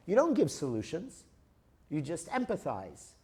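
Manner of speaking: normal talking voice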